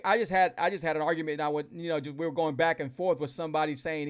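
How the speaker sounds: ordinary talk, nothing marked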